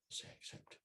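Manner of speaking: whispered prompt, barely voiced; retold whisper. Imam quietly prompting the groom: whispered. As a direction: hush the line like a quiet cue.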